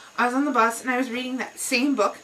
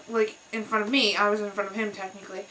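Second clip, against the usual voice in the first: ordinary talk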